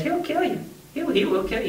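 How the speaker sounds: high-pitched mock warning; retold urgent warning. Foreman warns the hands about the bull, urgent warning. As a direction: imitate an alarmed foreman, pitch jumping up.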